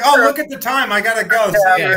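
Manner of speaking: high, loud brush-off, hurrying away; mock hasty exit. Host joking how the actress would dodge the crush talk, mock. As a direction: pretend to flee the awkward question. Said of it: "She's like".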